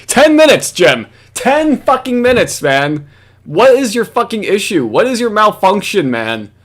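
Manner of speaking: high-pitched, incredulous outburst; exasperated rant. Real, not acted. Host berating Jim over ten minutes, exasperated. Ranting at Jim's impatience.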